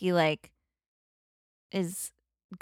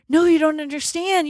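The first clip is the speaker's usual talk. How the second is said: urgent plea; 8 semitones higher, 4 dB louder than usual. Retold or imitated. Phil pleading with Andie MacDowell.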